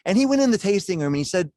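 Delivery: usual speaking voice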